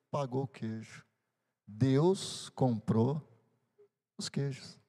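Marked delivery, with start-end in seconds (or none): 1.7-4.8 slow solemn emphasis. Real, not acted. Pastor drives home sermon point.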